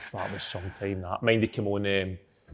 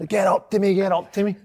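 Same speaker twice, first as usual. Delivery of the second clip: higher-pitched acting out of a player's call; retold urging call; 9 semitones higher than usual. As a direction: act out his eager call, pitched up. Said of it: "he's like".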